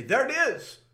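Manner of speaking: high, loud surgeon's exclamation; excited call. Retold or imitated. Surgeon spotting the clot in the heart, excited call. Retells surgeon calling out discovery.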